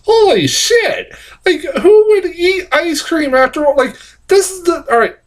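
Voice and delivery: high, laughing in disbelief. Real, not acted. Reviewer laughing over the ad, high disbelief. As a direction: laugh it out, still shaken.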